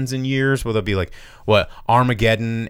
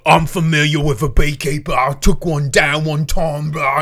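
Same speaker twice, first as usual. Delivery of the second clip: mock Australian accent; 6 semitones higher, 5 dB louder than usual. Mini boss villain boasting about beekeepers, mock Australian accent. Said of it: "a kinda New Zealand Australian accent".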